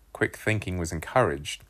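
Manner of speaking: plain reading voice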